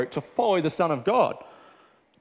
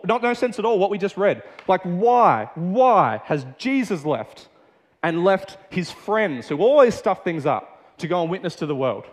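ordinary talk

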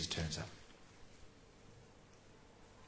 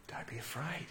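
hushed whisper, much softer, tender; gentle whisper; 2 semitones lower, 14 dB quieter than usual. Shown as a demonstration. Jesus whispering comfort to terrified disciples, hushed whisper. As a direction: whisper "afraid" like comforting a scared child. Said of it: "That, that feeling of touch".

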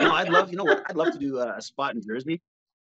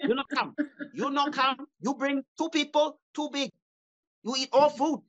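much higher mock Chinese accent, scolding; 11 semitones higher than usual. Restaurant owner banning a customer who eats too much, higher mock accent. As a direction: bark like an angry restaurant owner. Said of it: "from a Chinese food restaurant".

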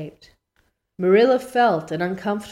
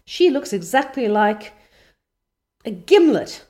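passionate; 4 semitones higher, 7 dB louder than usual. A narrator's reading. Anne's outburst continues.

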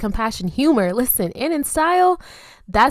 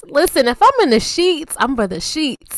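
playful emphasis; 7 semitones higher, 7 dB louder than usual. Real, not acted. Joking about being in bed early.

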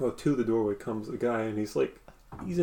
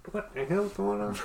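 higher muffled voice from afar; a voice heard through a wall, higher; distant startled voice; 8 semitones higher, 4 dB quieter than usual. Acted. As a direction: call out puzzled from another room. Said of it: "You hear, you hear a".

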